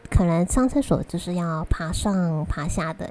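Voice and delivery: normal talking voice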